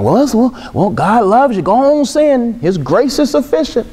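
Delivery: high, breezy excuse-making voice; lightly mock a permissive friend. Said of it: "We're around certain people like".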